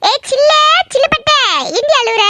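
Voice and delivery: put-on high-pitched cooing to a baby; baby talk. Visiting girl soothes crying baby, high baby talk. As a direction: coo at the crying little one.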